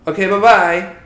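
sing-song "Okay, bye-bye" brush-off; chirpy flight attendant. Retold or imitated. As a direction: mimic a breezy dismissive attendant. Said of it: "That was this guy".

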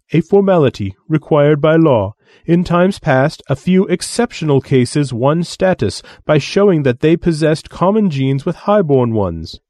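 lofty, condescending alien voice; haughty alien envoy. Acted. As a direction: sneer down at lesser beings.

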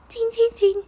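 very high squeaky cin cin cin; mouse squeak. A narrator's reading. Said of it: "cincogta a kis egér".

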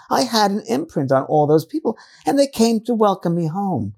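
put-on British accent. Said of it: "this British accent that she had".

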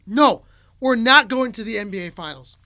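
firm refusal. Retold or imitated. Retelling Tom refusing the Finals trip, emphatic refusal. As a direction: lightly imitate someone putting his foot down. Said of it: "He refuses".

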